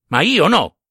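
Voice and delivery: high-pitched, emphatic refusal; indignant, pitch leaping up; indignant retort. A narrator's reading. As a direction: throw back an offended refusal. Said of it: "rispose pronto il vecchio indignato".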